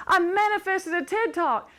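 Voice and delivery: high, loud re-enacted excitement; excited announcement. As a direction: brag happily to your girlfriends, acted out. Acts announcing news to girlfriends.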